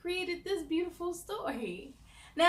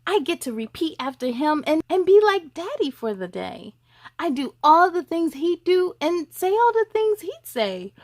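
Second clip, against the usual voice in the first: lively picture-book read, louder; reading to children; 4 semitones higher, 11 dB louder than usual. Acted. Host reads a boy's story to young viewers, louder storybook voice. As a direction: read it out to the little ones, bright and full.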